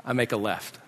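low, soft deadpan aside; understated, subdued contradiction; quiet defiance. Retold or imitated. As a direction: slip it in softly, disobeying the order. Dad contradicts the direction.

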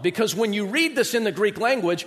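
ordinary talk

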